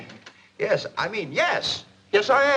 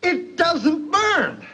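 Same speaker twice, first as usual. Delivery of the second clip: high, slow, stunned delight; drawn-out amazement; 11 semitones higher, 4 dB louder, much slower than usual. Acted. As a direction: let the wonder take over completely. Repeats, growing amazement.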